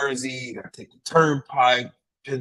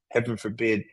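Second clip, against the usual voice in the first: ordinary delivery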